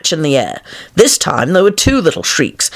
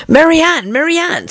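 high calling voice; 12 semitones higher than usual. A narrator's reading. The Rabbit calling for his maid, high and urgent. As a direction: call up the stairs for the maid. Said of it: "said the voice".

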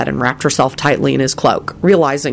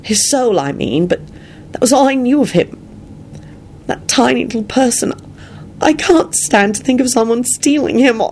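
high, fierce grief; distraught, near tears; 9 semitones higher than usual. A narrator's reading. Woman mourning someone stolen from her, distraught. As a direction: protest through welling upset, voice pitched well up. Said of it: "stopped talking as tears started".

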